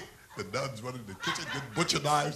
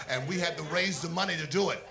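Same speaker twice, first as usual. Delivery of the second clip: ordinary talk, nothing marked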